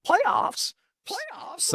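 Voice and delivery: very high incredulous squeal; Coach Mora. Retold or imitated. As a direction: mimic a coach's disbelieving rant in falsetto. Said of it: "Coach Mora and that one famous".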